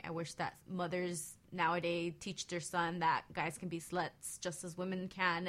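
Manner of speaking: ordinary talk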